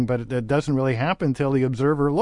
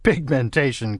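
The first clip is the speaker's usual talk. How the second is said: ordinary delivery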